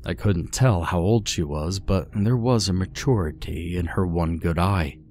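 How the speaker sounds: plain reading voice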